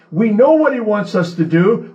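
ordinary talk

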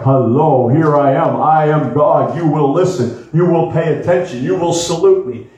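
mock-mighty voice of God, pitched low; big booming voice. Preacher mimicking God announcing himself in a big voice. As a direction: put on a big, low voice of command. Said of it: "He doesn't speak in that big voice like".

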